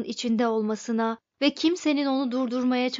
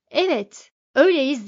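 loud, higher, emphatic confirmation; raised-voice assertion; insistent; 4 semitones higher, 8 dB louder than usual. A narrator's reading. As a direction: insist on it forcefully. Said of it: "diye ısrar etti Majiktis".

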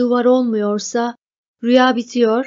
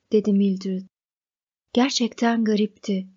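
ordinary delivery

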